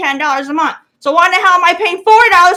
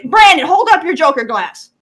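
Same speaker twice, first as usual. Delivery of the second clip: ordinary delivery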